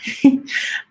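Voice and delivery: short breathy laugh on the edge of tears; teary breathless laugh. Real, not acted. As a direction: let a choked laugh slip out. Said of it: "I am about to cry".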